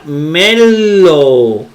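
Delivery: melo with a long, held l, loud and slow; wrong doubled consonant. Shown as a demonstration. Teacher showing students what not to say, melo drawn out. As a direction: stretch the l in melo way out. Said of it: "no se pronuncia".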